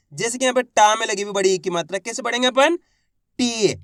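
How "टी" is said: टी with a long ee; drawn-out vowel. Shown as a demonstration. Teacher answering how it is read, टी drawn out. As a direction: stretch the vowel of टी. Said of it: "खींच के पढ़ेंगे".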